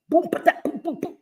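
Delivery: high, percussive mock booms; imitated booms. Shown as a demonstration. Streamer showing how diabetes messes her up, imitated booms. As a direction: punch out little high boom sounds.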